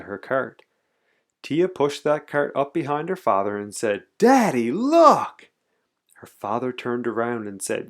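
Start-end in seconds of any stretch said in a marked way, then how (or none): 4.2-5.5 high, loud cry for attention; high-pitched, loud little-kid shout; excited child calling. A narrator's reading. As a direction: call Daddy over, thrilled. Child calls to show father candy.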